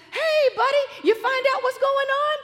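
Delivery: put-on very high, loud singsong hello to a child; eager high greeting. Aunt asking nephew for news by phone, high and eager.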